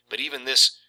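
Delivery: ordinary talk, nothing marked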